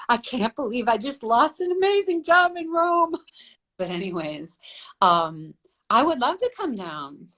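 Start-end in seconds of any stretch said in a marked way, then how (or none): 0.1-3.2 high-pitched, whiny mock wail; mock crying. Model recalling losing a job in Rome, mock crying. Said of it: "I remember crying down in Chile".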